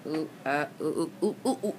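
usual speaking voice

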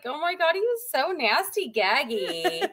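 put-on high Valley Girl storytelling; high Valley Girl accent. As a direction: keep it high and bubbly like a mall girl. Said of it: "in Valley Girl talk".

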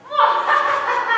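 loud high villain cackle; evil cackle. Shown as a demonstration. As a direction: throw back your head and cackle. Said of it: "Or more like".

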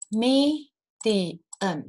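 "medium" split into three separate syllables; "medium" broken up as me, di, um. Shown as a demonstration. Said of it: "所以它有三个音节".

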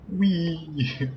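a high, playful whee; mock joyride squeal. Mocking party members riding the elevator, a high playful squeal. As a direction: voice the party having fun on a ride. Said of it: "but they're enjoying it".

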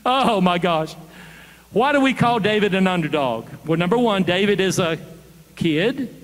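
usual speaking voice

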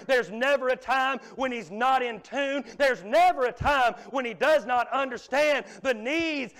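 loud, driving pulpit preaching; a preacher rising to full voice; preaching at full force. Acted. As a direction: hammer it home to the congregation.

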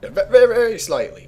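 stuttered VERY, high and loud; emphatic hedging. Real, not acted. Player qualifying his accent remark, high emphatic stammer. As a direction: burst out "very slightly" high, stumbling on "very".

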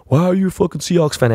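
high whiny mock question; mocking fans. Rams fans at the bar asking why he backs Seattle, mock whiny voice. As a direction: imitate annoying fans.

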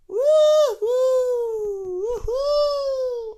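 excited whoop. Real, not acted. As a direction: let out a big thrilled whoop. Crash in chase. (coşkulu, yüksek sesli bir sevinç çığlığı)

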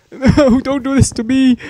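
high panicked yell; being chased. Real, not acted. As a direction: cry out as it catches you.

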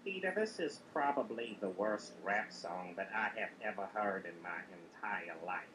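low, soft, deadpan verdict; mild deadpan critic; lightly voiced Cleveland. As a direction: deliver the insult gently and low. Cleveland judges the rap.

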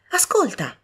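high urging call to listen; eager call. A narrator's reading. Young woman urging her sister again, eagerly. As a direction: insist she listen.

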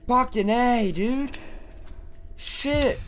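high breathless relief; adrenaline rush. Real, not acted. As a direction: still shaking from the fight. Aftermath of fight, adrenaline.

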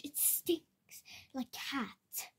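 hushed whisper about the smell; a whispered aside. Acted. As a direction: whisper it, disgusted. Whispered complaint about cage.

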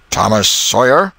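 full name called out like a warning; stern schoolmaster calling a name. Acted. Schoolmaster calling the late boy to account, stern and loud. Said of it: "his name was pronounced in full".

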